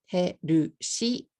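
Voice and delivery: "herushi" in three even Japanese syllables; katakana pronunciation. Shown as a demonstration. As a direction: say "herushi" slowly, he-ru-shi, as katakana English. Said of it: "If you say healthy in katakana".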